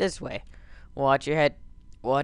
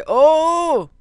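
startled cry; 13 semitones higher, 10 dB louder than usual. Real, not acted.

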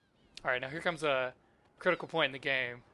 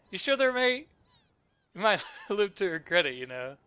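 louder, higher character voice, lightly acted; Kid's warning; 6 semitones higher, 8 dB louder than usual. Kid warning Serge he might regret refusing, lightly acted. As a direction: press them with a playful loud warning.

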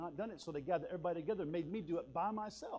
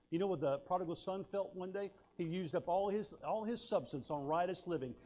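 ordinary talk, nothing marked

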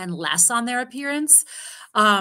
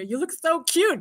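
doting praise; 8 semitones higher, 3 dB louder, slower than usual. Retold or imitated. Mother voicing praise to daughter.

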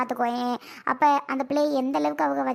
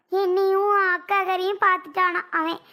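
normal talking voice

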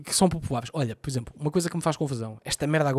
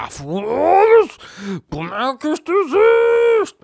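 much higher and louder, dragged out slowly; mock outrage; 13 semitones higher, 8 dB louder, much slower than usual. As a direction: ham up a disbelieving rant. Outrage hipotético imitado.

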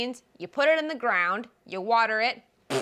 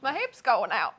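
normal talking voice

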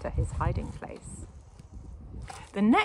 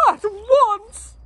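very high, loud command; stern order; 14 dB louder than usual. A narrator's reading. Father sending daughter to her room, loud. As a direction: bark it sharply. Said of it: "ordered Mister Larrabee".

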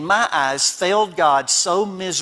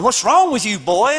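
lightly voiced dad, higher and louder; freaked-out dad; 4 semitones higher, 4 dB louder than usual. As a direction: imitate a dad demanding an answer. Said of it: "My dad's going".

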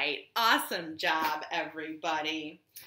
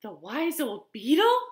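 higher awed question, then a gasp; shocked gasp; 8 semitones higher, 3 dB louder than usual. A narrator's reading. Animals hearing of the beetle, shocked gasp. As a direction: ask in alarm and suck in breath. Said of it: "gasped all the animals".

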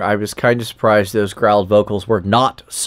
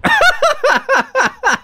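high-pitched laugh. Real, not acted. Co-host cracking up at the villain's motivation, high laughter. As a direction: burst of high, real laughter.

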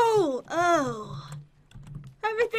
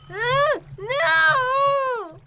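high, drawn-out cry of protest; alarmed wail; 7 semitones higher, 4 dB louder than usual. Real, not acted. Player chased by lookalike attacker, alarmed wail. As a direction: wail as danger closes in.

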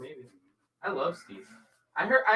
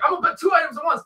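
loud, higher boastful declaration; mock shout; 6 semitones higher, 10 dB louder than usual. Cohost mocking the host's boast with a loud declaration. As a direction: blurt it like a cocky announcement.

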